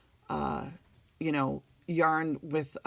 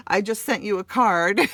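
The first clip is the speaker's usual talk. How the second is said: laughing; 6 semitones higher, 8 dB louder than usual. Real, not acted. Laughs while thanking her friend.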